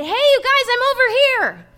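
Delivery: calling out loud and high across a distance; hollering. Retold or imitated. Calling out to three demons far off in the garden, loud high holler. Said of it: "I hollered to them".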